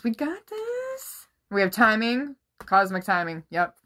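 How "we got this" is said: high pep squeak. Real, not acted. Self pep talk.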